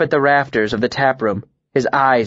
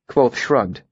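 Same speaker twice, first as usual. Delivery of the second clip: ordinary delivery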